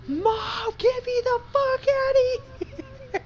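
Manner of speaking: high shrieking plea to mom; panicked kid voice. Pretend. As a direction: squeal it like a terrified kid. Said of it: "you probably turn the other way and be".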